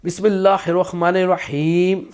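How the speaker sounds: slow, chanted Bismillah; teacher showing how to begin the recitation. Shown as a demonstration. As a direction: intone it slowly and carefully. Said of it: "ہم کس طرح پڑھ سکتے ہیں".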